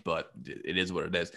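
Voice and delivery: normal talking voice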